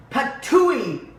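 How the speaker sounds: loud, disgusted spit-out; hammed-up patooey; spitting cry. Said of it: "cried big old Papa Bear".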